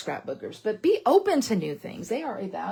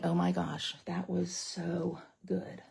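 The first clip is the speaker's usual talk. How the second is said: hushed mock awe; 3 semitones lower, 8 dB quieter, slower than usual.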